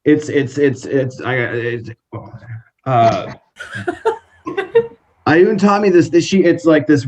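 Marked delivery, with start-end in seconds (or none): 3.6-4.2 laughing. Acted. Nervous laugh after question.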